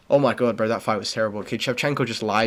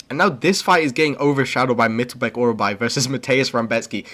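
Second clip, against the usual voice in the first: usual speaking voice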